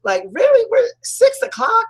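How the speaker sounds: high, squealing surprise at the time; shocked disbelief. Retold or imitated. Woman shocked to see the clock already says six. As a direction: gasp it out like you just lost the whole day.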